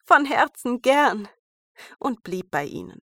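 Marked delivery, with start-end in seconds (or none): 0.0-1.3 etwas lauter und langsam; herzlich gern. A narrator's reading. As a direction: innig zusagen. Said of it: "sagte Schneewittchen". (herzlich und gedehnt)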